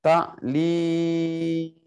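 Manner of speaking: very slow, long held middle vowel; drawn-out announcement. Shown as a demonstration. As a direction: stretch the middle syllable right out. Slowly calling out the search word.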